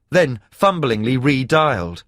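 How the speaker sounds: ordinary delivery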